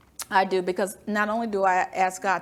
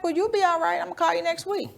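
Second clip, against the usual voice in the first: breezy reassurance; 7 semitones higher, faster than usual. Retold or imitated. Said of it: "I'm like".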